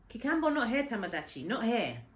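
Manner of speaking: loud, high call to drive the ape off; shooing call. Acted. Old man calls out to shoo Tamadachi away, loud.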